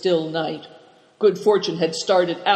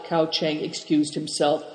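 usual speaking voice

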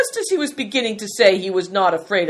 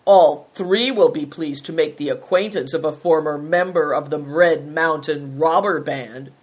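usual speaking voice

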